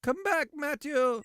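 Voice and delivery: high, drawn-out mock plea; mock anguish. Streamer calling Matthew back, high mock wail. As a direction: call after someone in hammed-up grief.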